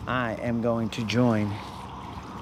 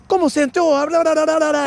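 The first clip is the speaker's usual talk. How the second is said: much higher and louder Spanish TV reporter; TV interviewer; 6 dB louder, faster than usual. Pretend. Mexican channel reporter interviewing the winner, high and eager.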